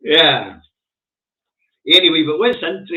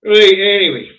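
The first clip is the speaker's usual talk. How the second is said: loud move on; 8 semitones higher, 10 dB louder than usual. Real, not acted.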